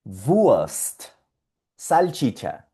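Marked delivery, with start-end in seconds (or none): 0.0-1.0 Wurst as vuast, R vocalized; German Wurst. Shown as a demonstration. Teacher modeling German Wurst. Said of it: "Salchicha en alemán es Wurst".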